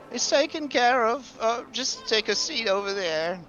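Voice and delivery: high, nervous clerk; fretful teller, pitched high; worried bank teller. Acted. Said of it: "this guy heads back and says".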